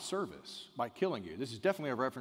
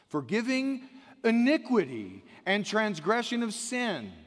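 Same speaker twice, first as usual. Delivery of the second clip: slow, louder solemn proclamation of the Lord's name; scripture proclaimed; 4 semitones higher, 6 dB louder, slower than usual. Acted. Preacher reading Exodus to the congregation, slow solemn proclamation. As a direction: declare every word like a herald.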